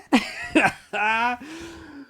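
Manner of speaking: high, giddy laugh; real gleeful laughter; delighted laugh. Real, not acted. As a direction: laugh high in sheer satisfaction. Laughs admiring the result.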